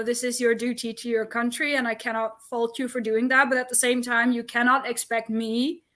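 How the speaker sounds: ordinary delivery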